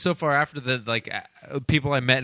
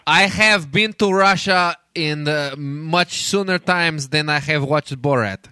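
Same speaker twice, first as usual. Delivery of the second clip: mock Russian accent; slower than usual. Keeps the Russian voice going.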